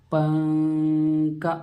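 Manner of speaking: पंका very slow, the n hidden in the nose; slow motion. Shown as a demonstration. Teacher slowly models पंका for students, nasal n. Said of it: "पंका को थोड़ा slow motion में बोलिए".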